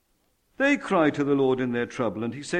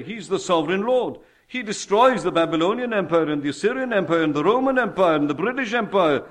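raised, forceful pulpit proclamation; preaching; 3 semitones higher, 4 dB louder than usual. Real, not acted. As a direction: proclaim it with weight and authority.

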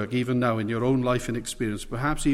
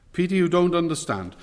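ordinary talk

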